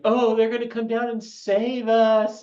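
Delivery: high, slow gushing imitation; mock naive hope. Mimics hopeful UFO believers.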